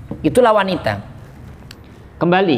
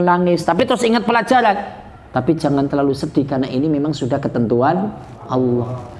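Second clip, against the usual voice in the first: ordinary talk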